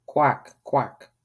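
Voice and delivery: high little quacks; ducklings quacking. Acted. Newborn ducklings greeting the world, quacking. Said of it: "They shook their wings and said".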